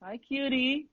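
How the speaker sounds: high-pitched pet-greeting voice; cooing to puppy. Real, not acted. Guest greets his puppy watching the stream, cooing.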